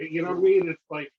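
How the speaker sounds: ordinary talk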